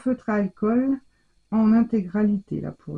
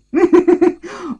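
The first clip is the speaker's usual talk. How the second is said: delighted high laugh; 10 semitones higher, 9 dB louder than usual. Real, not acted. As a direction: burst of high, loud laughter. Moved by gift, bursts laughing.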